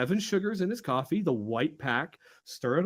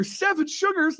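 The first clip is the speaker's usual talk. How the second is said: high, loud public yell; practically yelling; 10 semitones higher, 3 dB louder than usual. Retold or imitated. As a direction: yell it so the whole site hears. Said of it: "practically yelling so the other people could hear".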